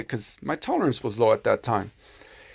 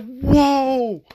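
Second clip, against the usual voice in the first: startled whoa; 10 semitones higher than usual. Retold or imitated. Nineteen-year-old recoiling from a stranger's offer, high startled whoa. Stranger propositions him in parking lot.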